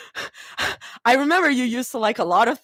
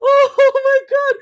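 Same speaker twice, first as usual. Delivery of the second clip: slow, loud, high wail; drawn-out high exclamation; overwhelmed squeal; 13 semitones higher, 7 dB louder, much slower than usual. Real, not acted. Overwhelmed by the chat.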